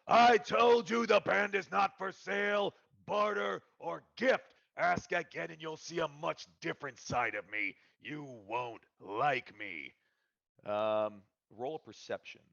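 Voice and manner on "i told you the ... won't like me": angry ogre threat. Acted. Ogre warning a bandmate's would-be buyer, menacing. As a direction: menace them, then let it drop. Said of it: "He says".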